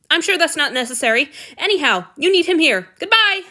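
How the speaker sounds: fast, high, loud flustered brush-off; flustered exit. A narrator's reading. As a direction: rush out of the room. Julia flustered, hurrying out.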